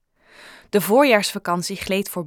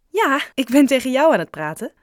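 high, lively and louder; light amusement, higher and louder; amused reply; 6 semitones higher, 6 dB louder than usual. A narrator's reading. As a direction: answer as if tickled by the question. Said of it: "lachte ze".

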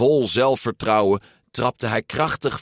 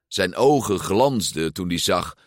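usual speaking voice

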